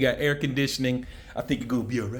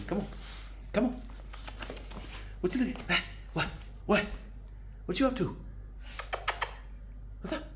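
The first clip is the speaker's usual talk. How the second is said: puppy talk; 7 semitones higher, 12 dB quieter, faster than usual. Real, not acted. Talking to the puppy.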